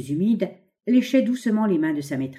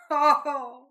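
quavering little laugh; 9 semitones higher than usual. A narrator's reading. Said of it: "ce petit rire chevrotant du microphone".

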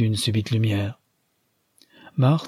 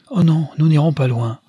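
higher and louder, quick to reassure; eager exclamation; 6 semitones higher, 5 dB louder than usual. A narrator's reading. Nastia reassuring her father, eager higher louder cry. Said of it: "s'écriait Nastia".

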